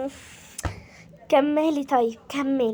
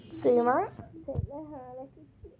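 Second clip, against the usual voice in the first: ordinary delivery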